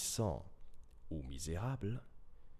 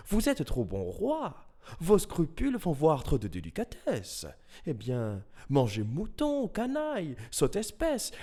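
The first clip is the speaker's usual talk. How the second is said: flattering fox; 5 semitones higher, 4 dB louder than usual. Acted. Fox excusing the lion king's crimes, fawning flattery. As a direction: start very high with mock outrage at any blame, then ease down.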